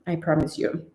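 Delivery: ordinary talk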